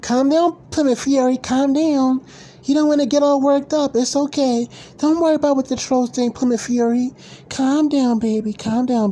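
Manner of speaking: high sing-song mockery of fans soothing a grown man; mock doting mom. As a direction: coddle him like an overprotective mother. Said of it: "like they're his mom".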